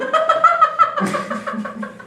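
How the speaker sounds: squealing high laugh. Real, not acted. Laugh mid-rant.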